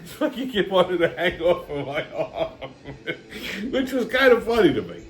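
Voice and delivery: talking through real laughter; laughing. Real, not acted. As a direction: crack up while telling it. Laughing at his own story.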